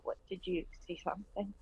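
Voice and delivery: soft, hesitant murmur; a small soft question after pulling away; timid question. Acted. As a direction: ask it quietly, looking down. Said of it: "I just kinda look down and I go".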